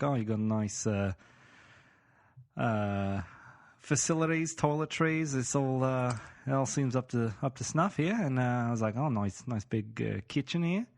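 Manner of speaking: put-on Aussie apartment inspector; Australian accent. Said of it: "let's get back into character here".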